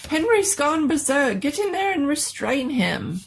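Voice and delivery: panicked master ordering his servant in; frantic doctor. Acted.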